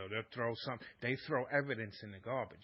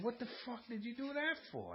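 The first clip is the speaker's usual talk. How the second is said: retold angry outburst; 12 semitones higher than usual. Husband confronting wife after she smashed the phone, mock angry. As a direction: demand an answer in retold anger.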